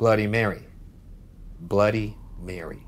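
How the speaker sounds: low, deliberate chant; low incantation at the mirror; ritual summoning. Real, not acted. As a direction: summon a spirit in a low, measured voice. Chanting the Bloody Mary ritual.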